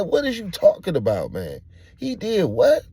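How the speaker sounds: loud, high incredulous demand; shocked outburst. Retold or imitated. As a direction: yell your disbelief at him. Acting out shock at news.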